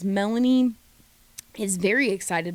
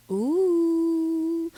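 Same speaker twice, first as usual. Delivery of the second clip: high, drawn-out intrigued ooh; 8 semitones higher than usual. Real, not acted. Host reacting to Bryce knowing the blonde, intrigued ooh. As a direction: savor the juicy gossip.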